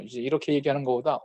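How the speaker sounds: ordinary talk, nothing marked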